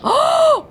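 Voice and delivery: shocked high gasp; sharp high gasp. Real, not acted. Shocked by shipment news.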